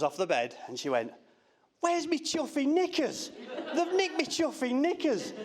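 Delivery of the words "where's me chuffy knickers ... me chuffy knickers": high, slow, dazed daughter voice; imitating daughter. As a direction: mimic her groggy outburst on the ward, pitched up. Daughter waking after surgery.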